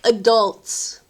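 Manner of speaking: ordinary talk, nothing marked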